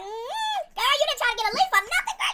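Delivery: put-on high, laughing "girl" scolding in disbelief; sassy reaction. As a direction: tease her like a girlfriend, laughing. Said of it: "I was like".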